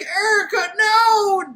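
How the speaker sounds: high, loud hammed-up call; mock anguished cry. Reviewer mimicking the professor calling Erica, high mock anguish. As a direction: cry out her name like a soap hero. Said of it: "he's like".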